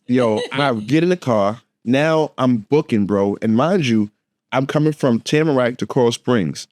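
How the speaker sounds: ordinary talk, nothing marked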